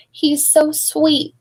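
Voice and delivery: high, slow and doting; mother's tender coo. Acted. Mother elephant cooing over her newborn, slow and high. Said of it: "said the mother".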